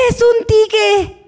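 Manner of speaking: put-on high, slow little-boy voice; small child. Little boy correcting the coworker, high and slow. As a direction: answer like a proud small kid.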